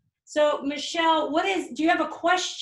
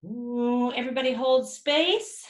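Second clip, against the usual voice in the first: healing invocation; 4 dB louder than usual. Acted. Healer releasing a spirit for the client, solemn invocation. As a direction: call the light in like a healer.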